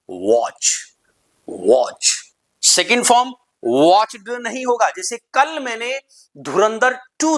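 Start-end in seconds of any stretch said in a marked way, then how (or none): 0.1-2.1 watch with a rounded o, "wotch"; low-pitched watch with oval lips; right pronunciation. Shown as a demonstration. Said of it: "right pronunciation is". (watch को "वॉच" जैसा गोल ओ से)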